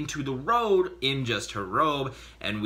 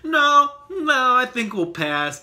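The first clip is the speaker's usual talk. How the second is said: mock refusal; 11 semitones higher, 7 dB louder than usual. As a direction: hastily turn down the offer, hammed-up. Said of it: "I'm just like".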